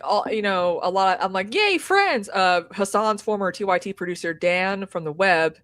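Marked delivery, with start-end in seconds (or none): 1.5-2.3 high-pitched little "yay"; mock cheer. Telling co-hosts about friends living nearby, mock cheer.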